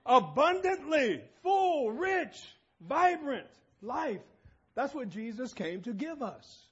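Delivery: loud, high, ringing proclamation; emphatic conviction. Shown as a demonstration. Preacher urging the congregation to mean it, emphatic and loud. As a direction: proclaim each word like you mean it. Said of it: "say it like you mean it".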